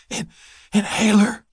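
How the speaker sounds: high, broken gasp of a word; wheezing. A narrator's reading. Asthmatic woman begs for her inhaler, wheezing. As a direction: stammer it out, short of breath. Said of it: "she wheezed".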